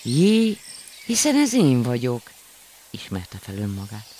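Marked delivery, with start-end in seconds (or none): 0.0-2.3 much higher, louder gasp of surprise; surprised to see himself; amazed discovery. A narrator's reading. Surprise at own reflection. (meglepett felkiáltás)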